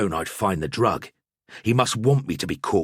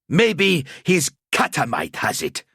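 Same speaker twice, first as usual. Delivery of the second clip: put-on slow, higher, gruff Bretonnian-accented accuser; 8 semitones higher than usual. A narrator's reading. Grubheimer accusing the priest in a strong Bretonnian accent. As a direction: point him out in a heavy Bretonnian accent. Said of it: "his voice gruff, his accent strong".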